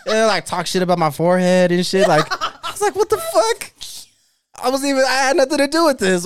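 talking high through giggles; can't stop laughing while telling it. Real, not acted. Telling her partner the story, laughing throughout.

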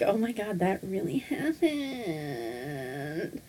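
awed hush. Real, not acted. Friend recalling the guest, awed fading hush. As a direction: let it fade in awe. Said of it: "to this day".

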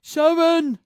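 high, loud mock Aussie shout; Aussie accent. Shown as a demonstration. Co-host showing how Aussie sounds, loud mock Aussie accent. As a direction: belt it out as a mock Australian. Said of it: "It just sounds like he's".

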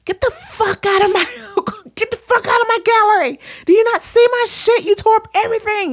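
very high, loud mock screaming; hammed-up furious yell, way up high; screeching rant. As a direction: shriek her out of the gallery. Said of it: "And she was just like".

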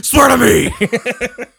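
huge drawn-out mock-menacing shout; hammed-up interrogator's roar; roared demand. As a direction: bellow it slowly, way up high. Acting out film's interrogation line.

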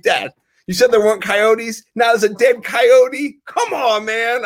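put-on high, loud whiny kid voice; shrill indignant daughter imitation; mock daughter. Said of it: "You know, like".

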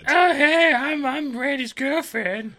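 put-on high, slow, ditzy girlfriend voice. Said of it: "That's what she talks like".

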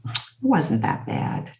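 low, soft, slow imitation of an ex; retold dismissive reply. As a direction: quote him shrugging it off, low and slow. Ex-husband brushing off her account of abuse.

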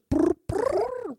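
high, bubbly creature noise; creature trill. Pretend. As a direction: trill it like a tiny alien. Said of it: "And it just goes".

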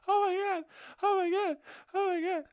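high, loud terrified repeats; panicked shrieks. Retold or imitated. Terrified seatmate during the drops, high loud shrieks. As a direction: shriek it over and over. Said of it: "And she's like".

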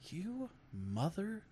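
soft, halting accusation; deadpan accusation. Retold or imitated. Bartender realizing she was fooled, deadpan accusation. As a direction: leave a pause after "You", keep it quiet. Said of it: "the fucking dead eye that I got".

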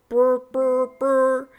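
loud buzzing phone noise. A narrator's reading. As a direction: buzz like a vibrating phone. Phone notification buzzing sound.

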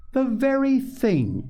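slow, high-pitched delighted echo; tickled echo. A narrator's reading. Missus Beebe echoing Polly's delight, tickled. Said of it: "tickled to death to see their delight".

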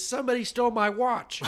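high, loud punchline delivery; hammed-up cry of complaint; mock outcry. Mexican passenger reveals the theft, high loud mock outcry.

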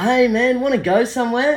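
put-on chummy high drunk bloke; high friendly drunk voice. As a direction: pitch up high, overly friendly slurred greeting. Drunk stranger propositioning.

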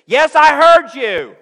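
mock a child talking back to mom; child snapping back at a parent, mocking; sassy child. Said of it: "said something like this".

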